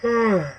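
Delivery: long tired yawn rising high then falling; yawning late at night, worn out; big yawn. Real, not acted. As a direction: let out a real sleepy yawn. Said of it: "I can't stop yawning".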